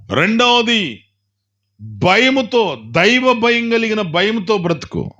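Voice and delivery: high, ringing preacher's call; preaching at full force. Real, not acted. Preacher urging the church to hold on through suffering, ringing and fervent. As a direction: lift the congregation with rising fervour.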